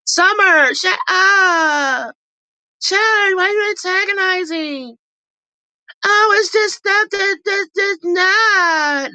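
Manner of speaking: very high, whiny mock voice; imitating Lex arguing. Vlogger imitating Lex shouting at Summer in a shrill mock voice. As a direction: squeal it like a petty reality star.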